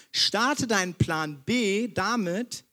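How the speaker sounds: drawn out, each word set apart; slow emphatic point. Shown as a demonstration. Preacher stating first sermon point, slow and weighty. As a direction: announce the sermon point slowly. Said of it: "zum ersten Punkt meiner Predigt".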